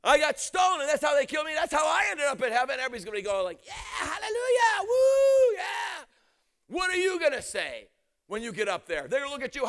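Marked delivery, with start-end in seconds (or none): 0.0-2.8 proud martyr's tale, pitched up; excited testimony. Acted. Stephen boasting in heaven, imagined. 3.7-6.0 high, drawn-out hallelujah whoop; acted jubilant cheer, voice soaring; cheering crowd. Everybody in heaven cheering, imagined.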